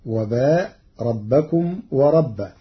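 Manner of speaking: ordinary talk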